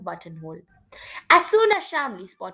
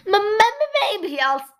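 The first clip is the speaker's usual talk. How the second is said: nervous stutter on the first sounds; stammered, hesitant line; stammering; 2 semitones higher, 4 dB louder than usual. Acted. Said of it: "he stammered after he read the sign".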